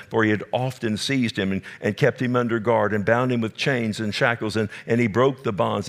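ordinary talk

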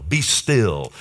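measured, commanding rebuke; firm rebuke. Retold or imitated. Jesus commanding the wind and water, firm rebuke. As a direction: order the storm to settle. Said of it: "He rebuked the wind and the raging".

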